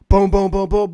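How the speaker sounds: loud booming knocks imitated with the voice; imitated door pounding. Telling the host how the cops banged on the door, loud mock knocking. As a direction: pound out each boom like a fist on the door.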